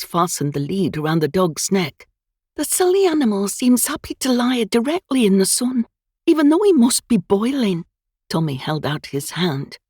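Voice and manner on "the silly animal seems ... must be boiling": put-on higher, louder young maid, eager and polite; a flustered housemaid apologising for the dog; maid's voice. Maid speaks to her lord.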